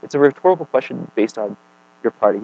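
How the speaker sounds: normal talking voice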